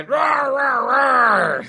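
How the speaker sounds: high roaring monster shout; possessed. Pretend. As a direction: roar like a possessed demon. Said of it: "That's my exorcist voice".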